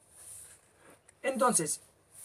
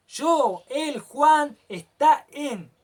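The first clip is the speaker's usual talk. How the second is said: "Yo", "él, Juan", "está en" lanzados fuerte y agudos, lo último lento; burlándose de cómo nos enseñan a decirlo; forma incorrecta exagerada; 5 semitones higher, 7 dB louder, much slower than usual. Shown as a demonstration. (ejemplo equivocado dicho fuerte y en tono alto)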